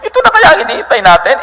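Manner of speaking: high, fast, breathless with a laugh; gushing to the villagers about her news; excited townswoman. Acted. Woman at the well telling the town, high and fast with excitement. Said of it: "sinabi niya sa mga taong bayan".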